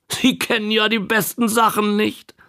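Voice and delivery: a hint of pitying old man. A narrator's reading. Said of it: "sagte er mitleidig".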